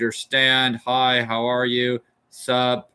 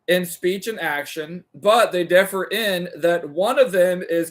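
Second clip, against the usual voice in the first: raised, deliberate reading of a philosophy text; formal reading aloud; 5 semitones higher than usual. Acted. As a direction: read it like a careful lecture recitation. Reads Aristotle translation aloud.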